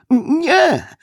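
very high cry of denial; frightened denial. A narrator's reading. The cornered suspect denies Westfield's last charge, frightened denial. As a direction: squeal the denial in panic.

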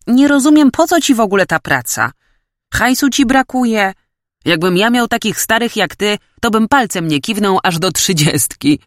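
lively, high-pitched ribbing between mates; needling young mate. Acted.

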